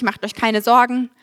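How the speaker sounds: ordinary talk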